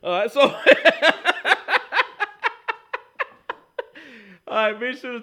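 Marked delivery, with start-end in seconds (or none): 0.6-3.2 high loud burst of laughter; big open laugh. Real, not acted. Laughing again.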